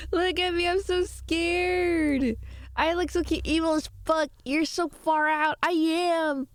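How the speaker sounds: squeaky, high mock-scared voice; high cutesy voice. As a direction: play cute and scared, up high. Playing a terrified avatar face.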